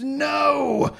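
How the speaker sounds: very high, loud shouted no; a desperate inner scream. Acted. The narrator's inner voice screams as she runs off, very high and loud. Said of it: "A voice in my head screamed".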